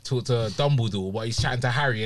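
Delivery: usual speaking voice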